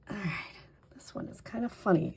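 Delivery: amused whisper to herself. Real, not acted. Crafter noticing the adhesive popped up, amused whisper. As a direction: whisper it like a small secret.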